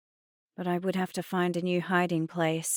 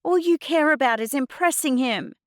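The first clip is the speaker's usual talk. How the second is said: high, loud hurt accusation; angry accusation; 9 semitones higher, 7 dB louder than usual. A narrator's reading. Girl to Mum about her boyfriend, accusing. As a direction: hurl the blame at her.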